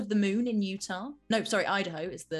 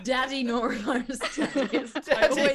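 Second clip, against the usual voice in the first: laughing; 4 semitones higher, 4 dB louder than usual. Real, not acted. Laughing at wrong NATO word.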